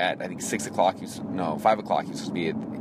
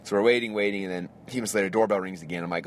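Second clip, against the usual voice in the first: usual speaking voice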